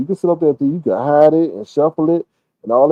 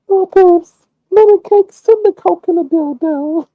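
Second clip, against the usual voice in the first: much higher cooing voice; cooing woman; 14 semitones higher, 4 dB louder than usual. Retold or imitated. Imitating a woman cooing pet names at Lemon, high and sweet. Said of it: "traffic coke for that man".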